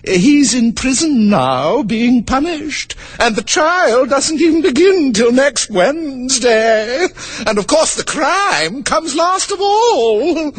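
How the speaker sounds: high, slow, rambling queen; high, drawn-out queen explaining. Acted. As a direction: muse on it like a dotty queen. Queen voiced in a story.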